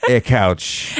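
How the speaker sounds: "eh couch", a short eh stuck on the front; "eh" run into "couch" like one word; added EH prefix. Shown as a demonstration. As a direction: put a little "eh" before "couch". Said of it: "You put eh in front of everything".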